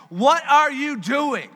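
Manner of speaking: exasperated outcry. Retold or imitated. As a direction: throw the question up in frustration. Imitating us complaining to God.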